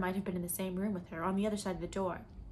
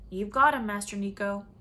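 deep man's voice; 7 dB louder than usual. Acted. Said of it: "That deep voice".

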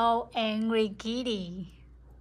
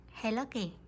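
normal talking voice

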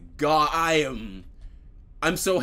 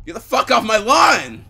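yelling; 7 semitones higher, 10 dB louder than usual. Real, not acted. Yelling at game enemy.

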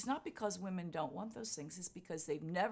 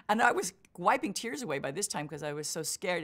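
normal talking voice

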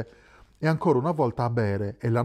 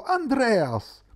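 much higher joyful shout of the name; 11 semitones higher than usual. A narrator's reading. Wojtek recognizes Andreas at the door, joyful shout. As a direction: yell his friend's name in delight. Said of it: "l'altro gridò".

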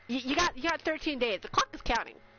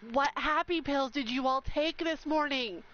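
usual speaking voice

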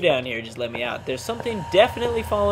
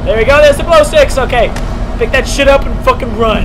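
loud, higher hurried calls; loud keyed-up urgency; urgent excited shouting; 7 semitones higher, 11 dB louder than usual. Real, not acted. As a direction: push yourself to grab it and run. Grabbing glow sticks in a hurry.

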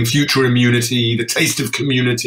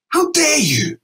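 indignant. Retold or imitated. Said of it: "And I said".

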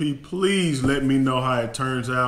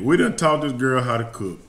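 ordinary delivery

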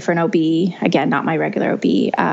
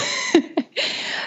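high giggle; 5 dB quieter than usual. Real, not acted. Laughs at own Christmas worry.

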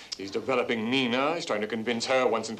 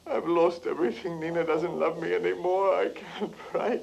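higher, strained, near tears; tearful despair; 6 semitones higher than usual. Acted. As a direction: let it break as you confess. Son breaks down to mother.

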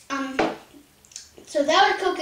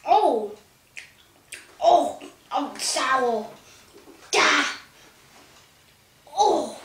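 sour shock; slower than usual. Real, not acted. Biting very sour candy.

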